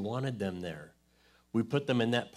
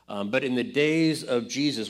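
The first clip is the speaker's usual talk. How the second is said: ordinary talk, nothing marked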